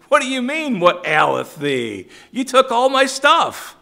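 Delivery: high loud exasperated protest; indignant Micah. Retold or imitated. Micah throwing the Danites' question back, high and outraged.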